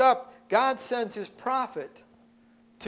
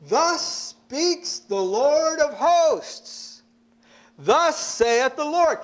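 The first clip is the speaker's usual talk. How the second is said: slow, loud, weighty proclamation; booming pulpit pronouncement; proclaiming; 6 semitones higher, 4 dB louder, much slower than usual. Acted. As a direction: declare it slowly like a herald. Preacher proclaiming God's word.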